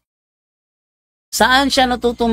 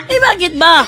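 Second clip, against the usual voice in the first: high laughing yell "Eh, bakit ba?"; laughing mock shout; 9 semitones higher, 5 dB louder than usual. Preacher acting out the atheist's laughing retort.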